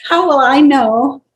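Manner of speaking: loud, high, drawn-out question; amused exclamation. Real, not acted. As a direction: exclaim it through your amusement. Laughing at her own puzzle.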